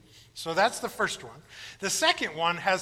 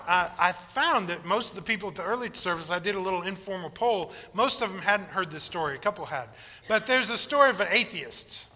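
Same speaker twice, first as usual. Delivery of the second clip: normal talking voice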